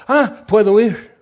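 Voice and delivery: put-on light up at finding a church for her kids; pleased young mother. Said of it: "diría".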